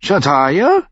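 high, loud incredulous question; annoyed. A narrator's reading. Tyrion questioning Bronn, annoyed loud rising question. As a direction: repeat the name sharply, rising high. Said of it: "fragte Tyrion ärgerlich".

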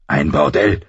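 low, contained indignation; understated anger. A narrator's reading. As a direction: voice Ned's restrained outrage.

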